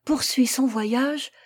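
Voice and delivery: slow, declaimed verse; declaiming. A narrator's reading. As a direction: recite poetry with resolve, line by line. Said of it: "Elle déclama la suite". (déclamation lente)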